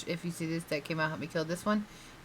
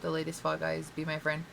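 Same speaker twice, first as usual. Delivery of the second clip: ordinary talk, nothing marked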